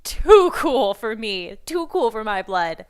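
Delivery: loud, gushing retold fangirl reaction; excited gush. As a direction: blurt it out, overwhelmed by how cool it is. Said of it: "And I just was like".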